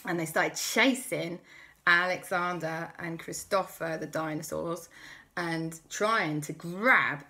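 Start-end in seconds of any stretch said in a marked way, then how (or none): none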